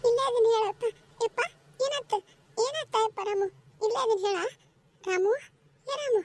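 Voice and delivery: mother urgently calling her son as he stirs. Acted. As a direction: call out to him desperately. Mother calls out to waking son.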